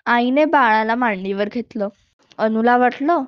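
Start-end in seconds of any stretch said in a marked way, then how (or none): none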